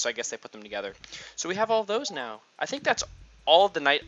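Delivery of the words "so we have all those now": ordinary talk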